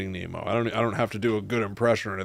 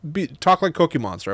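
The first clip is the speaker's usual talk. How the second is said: lightly voiced demanding child, higher and louder; imitated little daughter; 7 semitones higher, 5 dB louder than usual. Parent imitating daughter asking for characters, higher child voice. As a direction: put in a child's loud request. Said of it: "she'll be like".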